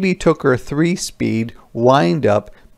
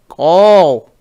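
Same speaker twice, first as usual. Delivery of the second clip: put-on high, loud baby shout; little one insists; baby cries go; 4 semitones higher, 8 dB louder than usual. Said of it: "cried the baby".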